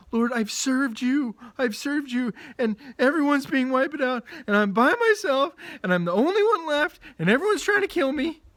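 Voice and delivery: frightened prophet protesting to God; desperate Elijah. Acted. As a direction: pour it out like a man at the end of his rope.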